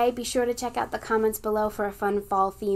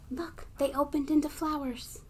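hushed wonder; 5 semitones higher, 5 dB quieter than usual. Acted. Gasped reveal.

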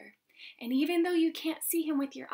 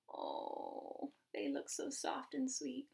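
whisper; 3 semitones higher, 11 dB quieter than usual. A narrator's reading. Hushed reading to children.

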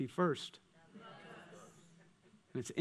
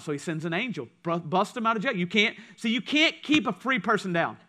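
ordinary talk

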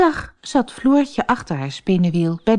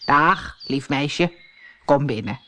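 put-on lower, lightly hoarse old woman; 4 semitones lower than usual. Old woman welcomes Floortje at the door, lightly hoarse. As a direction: greet her like a rasping old crone. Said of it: "met een schorre stem".

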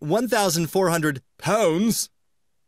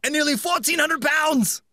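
hammed-up English accent; 8 semitones higher than usual. Host keeps doing the English accent, friends beg him to stop. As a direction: shout it in a silly English accent. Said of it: "Someone stop him".

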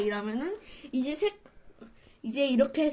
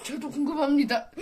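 ordinary talk

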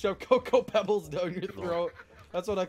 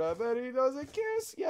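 mock meme voice; 8 semitones higher than usual. Continuing the meme quote.

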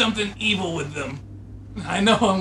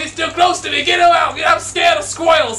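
high, frantic shouting for help; hammed-up panic; 9 semitones higher, 6 dB louder than usual. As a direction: yell in comic terror, pitch way up. Panics as squirrel gets near him.

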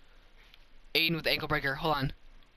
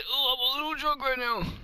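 mock drunk voice; 9 semitones higher than usual. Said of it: "He said".

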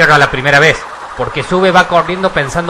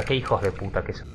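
cursing under breath; 7 semitones lower, 13 dB quieter than usual. Real, not acted. Frustrated gamer curses at trap.

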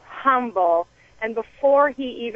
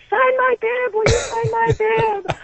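much higher, eager begging; excited fan plea; 13 semitones higher, slower than usual. Retold or imitated. To the Boston winner in the food court, in a much higher, gushing fan plea. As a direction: gush like a starstruck fan.

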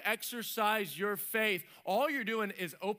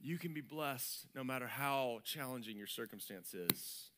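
low, soft scripture reading; solemn reverence; 9 semitones lower, 7 dB quieter than usual. Real, not acted. Preacher reading of Christ's love to congregation, solemn reverence. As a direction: read it gently and low.

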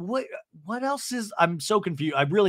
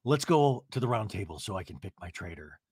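mock serious Teddi; 8 semitones lower, 3 dB quieter than usual. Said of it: "she's being very serious".

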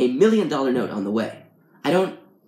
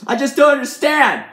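very high, loud wail; hammed-up exasperated yell; anguished shout; 13 semitones higher, 8 dB louder than usual. A narrator's reading. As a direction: cry it out in total bafflement. Man loses patience completely.

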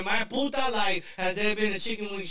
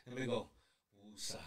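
calming breath; 10 semitones lower, 15 dB quieter than usual. Real, not acted. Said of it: "Sasha says I need to take a deep breath".

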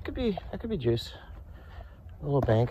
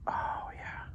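whispered satisfaction; 8 dB quieter than usual. Real, not acted. Fish lure behaving well.

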